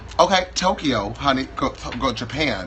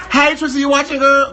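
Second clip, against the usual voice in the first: higher and louder, calling out to the camera; 10 semitones higher, 6 dB louder than usual. Acted. Greeting [name] watching at home, loud call-out. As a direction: shout a greeting to a far-off friend. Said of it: "home of Trixie Mattel".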